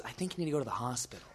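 quick, very soft aside; mock-worried undertone; hushed concern. Other girl delivers grave advice.

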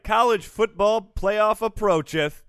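loud, slow scriptural pronouncement; mock-biblical proclamation. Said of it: "Is that a verse of the Bible?".

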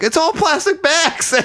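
very high, drawn-out mock yell; retold yelling. Homeless man complaining about plastic bags, mock yelling. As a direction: imitate an outraged complaint, high and slow. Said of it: "he starts yelling".

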